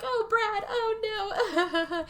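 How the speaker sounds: high-pitched mock weeping; hammed-up helpless whimper; clingy whimpering. Wife clinging to her husband, imitated tearful whimper. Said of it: "she's clinging to him".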